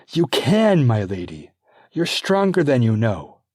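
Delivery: emphatic coach; insistent midwife. Acted. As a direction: push the belief into her. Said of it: "I can't".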